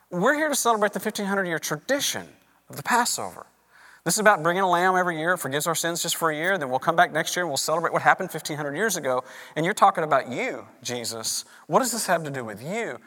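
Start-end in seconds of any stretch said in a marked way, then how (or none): none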